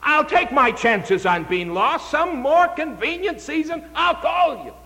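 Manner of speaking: high pleading excuse-maker; whiny stalling voice. Acted. Preacher voices Felix stalling.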